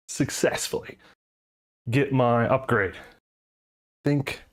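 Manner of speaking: panting between words after push-ups; breathless, catching air; out of breath. Real, not acted. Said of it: "sorry, I'm out of breath".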